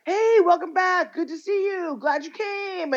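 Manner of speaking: high-pitched, overjoyed welcome; mock friendly greeting. Students welcoming the wrong twin back to college, high and gushing. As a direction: imitate a gushing crowd of greeters.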